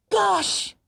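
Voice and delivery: put-on very high, loud, delighted child; thrilled boy, very high and slow; excited boy. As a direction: squeal with joy over the gift. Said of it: "cried Jonathan".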